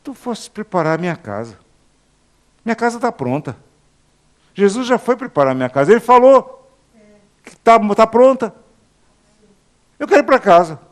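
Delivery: father pleading to go home; preacher voicing his dying father's prayer; father longing for home. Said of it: "ele dizia assim".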